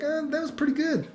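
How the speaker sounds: high, soft, noncommittal "eh"; lukewarm approval. Retold or imitated. Vlogger recalling his verdict on pickup artists, lukewarm. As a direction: give a grudging shrug of approval, pitched high.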